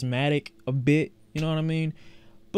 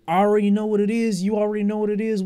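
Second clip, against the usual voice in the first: hyped; 6 semitones higher, 4 dB louder, faster than usual. Real, not acted. Hyped reaction.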